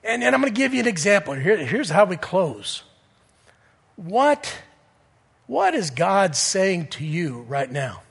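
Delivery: ordinary talk, nothing marked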